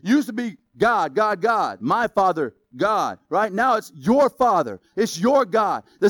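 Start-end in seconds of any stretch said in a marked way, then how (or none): none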